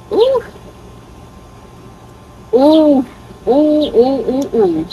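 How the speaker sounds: high, excited cooing oohs; delighted oohs. Real, not acted. Fragrance reviewer swooning over her new perfume, high excited oohs. Reacting to the scent.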